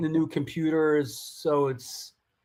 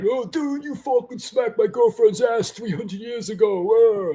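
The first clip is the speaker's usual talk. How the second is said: higher, half-joking accusing whine; mock outrage; 5 semitones higher than usual. Host imagines what the insult was about, mock outraged. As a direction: play it as a mock indignant accusation. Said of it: "I'm like".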